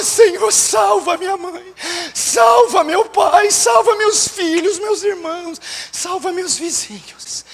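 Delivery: high-pitched pleading, fading at the end; acted tearful prayer. Believer begging God to save the family, acted pleading. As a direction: beg God as if weeping for them. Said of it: "não chorou como devia".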